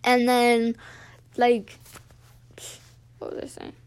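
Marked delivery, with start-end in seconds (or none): 3.2-3.7 breathy aside to herself, barely voiced; half-voiced as she loses her thought mid-story; muttering to herself. Real, not acted. Vlogger trails off to herself, barely voiced.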